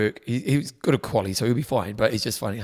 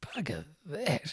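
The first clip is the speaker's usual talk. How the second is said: slow, breathy whisper under the breath; whispered aside; 3 semitones higher, 13 dB quieter, much slower than usual. Real, not acted.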